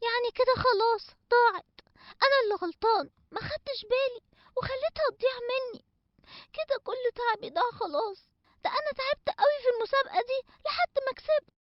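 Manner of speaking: soft, teary self-blame; quietly near tears over the lost prize; tearful regret. Acted. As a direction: sad character mourning his lost golden egg.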